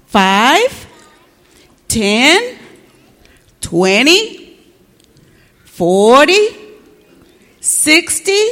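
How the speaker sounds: each number from FIVE to SIXTY stretched and rising; deliberate counting. Acted. Cashier counts the bills aloud, deliberate rising count. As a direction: count out FIVE, TEN, TWENTY, each left hanging upward.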